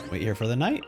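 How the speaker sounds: ordinary talk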